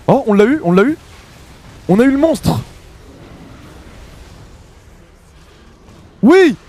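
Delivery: high, loud victory yell; excited shouting. Real, not acted. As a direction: shout it out in triumph. Team kills the enemy carry.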